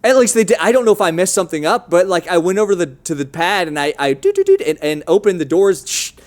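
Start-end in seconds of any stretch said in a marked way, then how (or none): 4.1-4.6 high, quick keypad beeping noises; imitated keypad beeps. Mimics working the keypad. 5.9-6.1 short hushing door noise; imitated door whoosh. Mimics doors sliding open.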